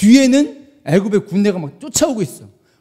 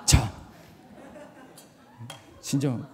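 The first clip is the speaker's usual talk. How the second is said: very low, hushed self-reminder; calming oneself; 10 semitones lower, 14 dB quieter, slower than usual. Real, not acted. Preacher settling himself after an outburst, hushed self-calming. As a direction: drop right down, talking yourself down.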